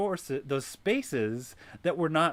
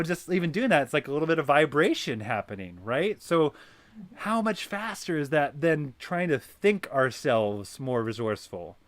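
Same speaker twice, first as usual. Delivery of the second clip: ordinary talk, nothing marked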